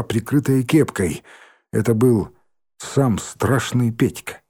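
usual speaking voice

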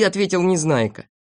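plain reading voice

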